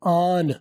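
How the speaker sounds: आन, aren't with the t dropped; "आन" ending on n, no t; aren't said as aan; weak aren't. Shown as a demonstration. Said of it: "टी नहीं बोला जाएगा".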